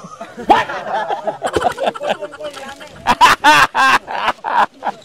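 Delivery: long, high burst of laughter; hearty laugh. Real, not acted. Host laughs at her own joke about the candy, hearty laughter.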